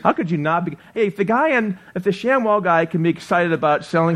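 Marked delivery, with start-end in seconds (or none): none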